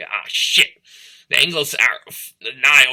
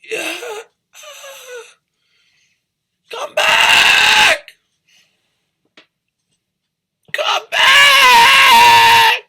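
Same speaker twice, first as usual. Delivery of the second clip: very high, loud, strained shout; Rose's cry; 7 dB louder, much slower than usual. Retold or imitated. Rose crying out to the rescue boat, high desperate shout. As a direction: scream after the boat, desperate. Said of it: "It sounds just like her".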